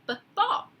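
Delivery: b, ball sounded out high; teacher sounding out a letter; stretched b sound. Shown as a demonstration. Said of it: "B like b".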